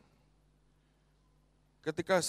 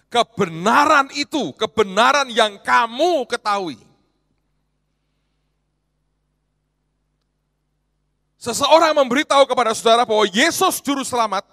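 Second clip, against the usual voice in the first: louder, higher fervent preaching; full-force preaching; 7 semitones higher, 12 dB louder than usual. Acted. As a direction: preach it to the whole hall.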